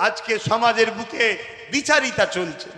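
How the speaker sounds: ordinary talk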